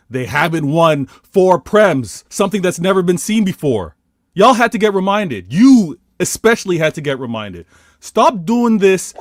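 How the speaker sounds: worked-up fan ranting; impassioned rant. Real, not acted. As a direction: tell the doubters off loudly.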